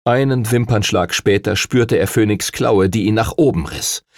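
plain reading voice

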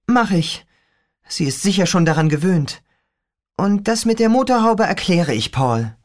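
put-on a young girl griping about her father; teen girl voice. Rachel reassuring.

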